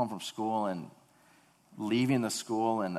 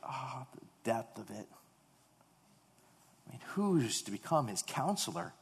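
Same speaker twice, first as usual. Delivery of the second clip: hushed awe; 7 dB quieter than usual. Retold or imitated. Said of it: "look at and say".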